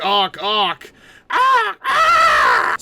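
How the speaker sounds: loud building sneeze; a real sneeze bursting out. Real, not acted. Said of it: "Sorry, I sneezed".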